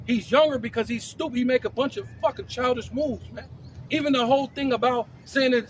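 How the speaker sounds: usual speaking voice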